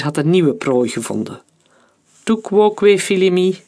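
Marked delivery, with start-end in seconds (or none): 2.2-3.6 high, loud Latin declaimed grandly; theatrical. Acted. Major-general quotes Caesar to the traitor, theatrically. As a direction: ham it up like Caesar on stage. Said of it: "zei hij theatraal".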